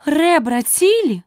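high, slow anxious question; worried. A narrator's reading. Alice helps the fallen knight up, asking worriedly. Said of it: "вона стурбовано запитала".

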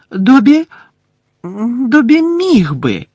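high squeaky elf, slow and unsure; hesitant elf. Acted. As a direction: falter like a scared little elf. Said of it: "невпевнено промовив він". (невпевнений писклявий голос ельфа)

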